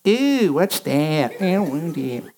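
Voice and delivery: put-on whiny toddler. Baby at the table squealing, lightly voiced. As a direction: squeal it like a picky child. Voicing baby Aaron refusing food.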